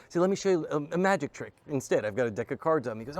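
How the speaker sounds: ordinary delivery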